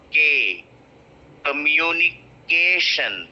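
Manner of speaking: COMMUNICATION slowed, with KAY stretched and punched; stress on KAY. Shown as a demonstration. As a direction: lean long and hard on the KAY in COMMUNICATION. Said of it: "I will be stressing this one".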